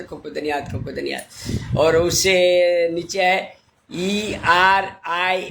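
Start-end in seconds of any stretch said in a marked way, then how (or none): 0.0-1.8 fading thanks. Real, not acted. Repeated thanks fading away.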